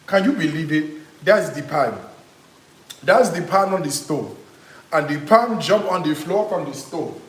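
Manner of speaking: lightly imitated Nigerian accent, th as d; playful Nigerian accent telling a runaway pan story; mock Nigerian accent. Cook retelling the jumping pan to viewers, light Nigerian accent. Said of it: "Nigerians do. I'm not Nigerian".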